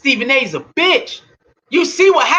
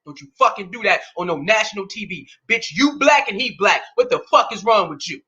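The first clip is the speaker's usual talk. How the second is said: imitating Durant's mother; 6 semitones lower than usual. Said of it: "Kevin Durant mama saying".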